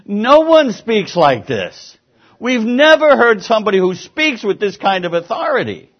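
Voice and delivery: high, loud astonishment, acted out; amazed. Preacher voicing people who heard Jesus speak, amazed. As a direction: proclaim it in mock amazement as the crowd. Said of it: "people were amazed".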